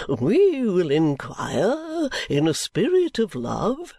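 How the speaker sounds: high, slow, unctuous sermonising; oily preacher voice, drawn out; pompous preacher. Acted. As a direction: play the greasy self-satisfied preacher. Chadband sermon.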